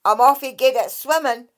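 the Dracai begging Kate to carry him to sea; exasperated water fairy. Acted. Lightly voiced impatient sprite.

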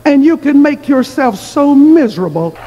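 high, projected preacher voice, building in pitch; measured opening rising to a high, forceful cry; emphatic preaching. Real, not acted. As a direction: drive the moral home to the congregation. Drives home the sermon's moral.

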